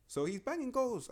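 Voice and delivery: excited. Real, not acted. Rating a striker, hyped about his goals, high excited voice. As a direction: pitch jumps high, slow and drawn out on banging.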